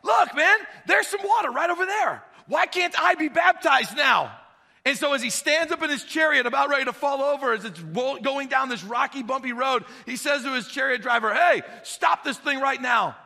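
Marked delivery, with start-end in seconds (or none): 0.0-4.4 high, loud excited shouting; all excited. Retold or imitated. Eunuch shouting to Philip, thrilled, high and loud. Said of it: "all excited". 11.3-13.2 loud, slow command to stop; urgent order. Retold or imitated. Eunuch yelling at his chariot driver to halt, loud.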